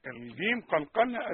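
ordinary delivery

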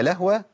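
"لهوة" as two beats, lah-wa; vowelless H. Shown as a demonstration. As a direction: show the error: still the H in "لهوة". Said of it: "تسكين الهاء". ("لهوة" بتسكين الهاء)

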